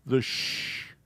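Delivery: just the sh sound, breathy. Shown as a demonstration. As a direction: hiss sh unvoiced, soft. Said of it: "this is".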